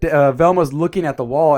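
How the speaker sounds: normal talking voice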